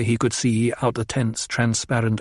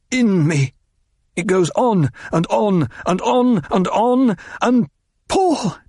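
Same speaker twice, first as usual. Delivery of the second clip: higher and higher until it breaks into a cry; edging into hysteria; 8 semitones higher than usual. A narrator's reading. Paul describing the thing inside him, spiralling into hysteria. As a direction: let the panic run away with you. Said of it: "heard the hysteria edging his voice".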